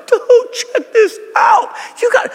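very high slow excited shout; excited Peter. Pretend. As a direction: yell it like a thrilled kid. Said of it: "the dude's like".

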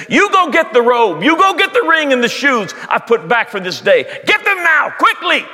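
high and loud, ordering servants to hurry; father shouting orders to his servants; urgent command. Acted. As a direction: bark the orders, no time to waste.

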